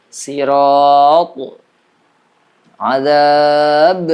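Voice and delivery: sirat and adab ending in a loud echoing bounce; strong qalqalah. Shown as a demonstration. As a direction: bounce the final letters of sirat and adab hard. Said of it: "Kalau yang kubra". (pantulan kuat di akhir sirat dan adab)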